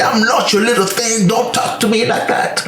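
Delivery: a hint of haughty defiance, voiced high; mock defiant retort. Tenant talking back to the landlord, high mock defiance. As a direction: toss it back at them with sass.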